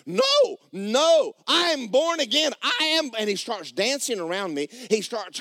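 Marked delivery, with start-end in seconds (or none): 0.1-3.1 high, loud imitated elation; ecstatic insistence. Neighbour insists to doubting maintenance man, ecstatic shouting. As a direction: insist at the top of your voice, overjoyed.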